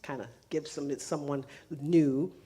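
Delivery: usual speaking voice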